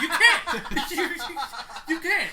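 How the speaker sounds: triumphant cackling "you can't"; laughing taunt. Real, not acted. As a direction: crow it through laughter. Gloating taunt, laughing over it.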